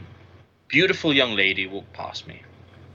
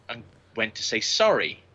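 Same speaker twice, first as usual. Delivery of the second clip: ordinary talk, nothing marked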